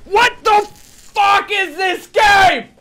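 high, loud, tearful shouting; furious tearful gamer tantrum; real crying rage. Real, not acted. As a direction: wail and shout like a meltdown. Meltdown over missing paint.

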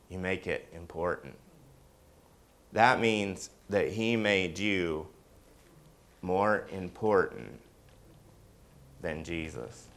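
hushed earnest emphasis; soft emphasis. Real, not acted. Emphatic point, dropped softly.

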